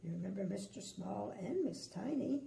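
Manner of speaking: ordinary delivery, nothing marked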